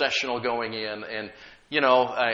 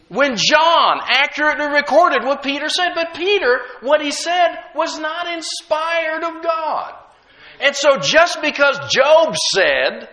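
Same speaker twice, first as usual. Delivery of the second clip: high, loud, building sermon cry; preaching at full force; 9 semitones higher, 6 dB louder than usual. Acted. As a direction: preach it to the rafters with fire.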